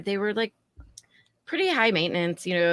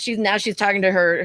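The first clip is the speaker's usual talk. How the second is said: ordinary delivery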